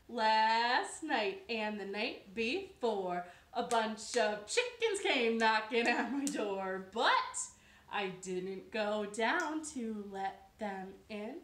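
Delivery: slow, loud sing-song rhyme; rhyme read to children. A narrator's reading. Storyteller chanting the rhyme to listening kids. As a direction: chant it out like a bedtime verse.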